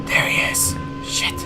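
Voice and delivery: hushed, breathless whisper; frightened whisper from a hiding spot. Real, not acted. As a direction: whisper so he won't hear you. Spotting the monster while hiding.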